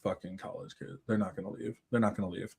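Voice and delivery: low, very soft muttering; grumbling to himself. Acted. Killer grumbling about college kids on his land, low soft mutter. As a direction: mutter wearily under your breath.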